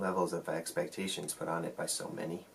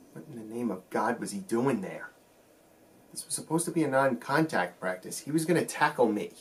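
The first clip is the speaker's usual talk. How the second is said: incredulous outburst; 2 semitones higher than usual. Acted. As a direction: protest it as if blindsided by a friend. Reliving shock at teammate's unexpected tackle.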